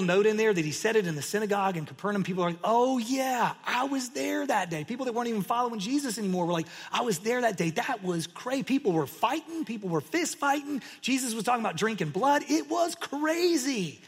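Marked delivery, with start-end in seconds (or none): 2.6-12.4 high-pitched, acted amazement; hyped-up mock reminiscing, pitched high; excited eyewitness. Acted eyewitnesses excitedly recalling the brawl. 12.5-14.0 very high, drawn-out CRAZY; hammed-up, slow, high-pitched amazement; amazed exclamation. Acted witness climax, then calm repeat.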